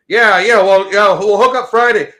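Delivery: loud, high, pompous phone voice; mock big shot. Mocking a loud phone talker on the bus, pompous. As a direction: play a self-important guy on the phone. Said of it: "like they're fucking a C E O".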